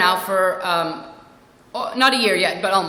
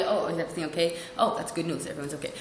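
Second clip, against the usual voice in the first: lower, softer relieved reply; casual; 4 semitones lower, 6 dB quieter than usual. Retold or imitated. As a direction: take the news calmly and quietly. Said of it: "if they were casual, I'd be".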